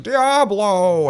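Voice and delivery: a high, loud hammed-up cry of the name; mock-epic yell from the bearded guy in the room; booming NPC shout. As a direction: bellow it like a dramatic game character. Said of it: "he's like".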